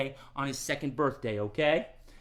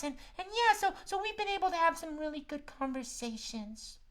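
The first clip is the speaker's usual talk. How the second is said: high puppet cat; 13 semitones higher than usual. Acted. Max the cat greets the kids, high puppet voice. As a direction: squeak it out like a little cat.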